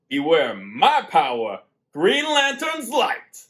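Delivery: loud, high heroic proclamation; theatrical oath. Acted. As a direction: boom out the superhero oath, drawing out the end. Theatrical oath to set the mood.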